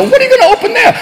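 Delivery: squeaky mock disbelief; incredulous high question. Comic asking the government in mock disbelief.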